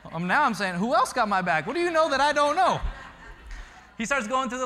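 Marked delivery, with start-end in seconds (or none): 0.8-2.8 loud, high-pitched mock demand; eager questioning. Dad playfully grilling his son for names, eager mock demand. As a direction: press for answers, climbing higher and louder.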